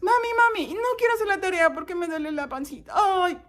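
high, loud, hurried whine; child faking pain. Acted. Child lying to mom again, whiny. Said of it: "Y son mentiras".

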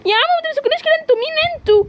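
very high, frantic protest; terrified cry. Acted. Girl seized by a gang, very high terrified cry. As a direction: scream at the men to let go. Thugs close in on her.

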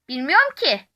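ordinary delivery

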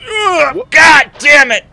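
loud, high, furious outburst at the game; furious shout. Real, not acted. Streamer yelling at the game after falling, furious shout. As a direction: explode at the failed jump. Streamer fails jump again.

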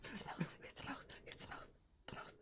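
whispered mumble. Real, not acted. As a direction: whisper the words to herself. Mumbling repeating under breath.

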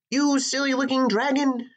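high-pitched indignant princess; ranting princess shout. A narrator's reading. Captured princess to the dragon who carried her away, indignant high shout. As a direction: rant at him in a high princess voice. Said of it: "she shouted".

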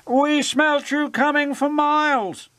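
high, grand, mocking engine voice; grand wheezing engine. A narrator's reading. Gordon mocks smelly Whiff, wheezing grandly. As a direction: wheeze it out grandly, pitched right up. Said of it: "wheezed Gordon grandly".

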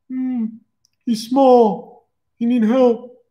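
a touch of a simple brute; slow, simple concern; lightly voiced Jason. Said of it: "Jason's like".

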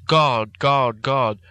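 loud desperate cry to God; called out loudly. A narrator's reading. Patient crying out alone in his room, loud desperate call. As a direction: cry out God's name, loud and pleading. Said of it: "had called out loudly several times".

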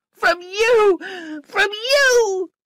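very high, loud, repeated accusation; angry child's shriek. A narrator's reading. Flora rejecting her governess, angry shriek. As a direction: fling it back at full pitch.

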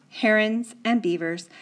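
ordinary delivery

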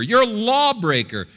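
high-pitched mock accusation; accusing voices. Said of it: "they would say".

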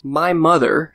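ordinary delivery

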